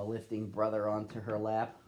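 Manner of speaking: usual speaking voice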